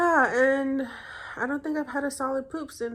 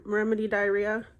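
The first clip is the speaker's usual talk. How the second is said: usual speaking voice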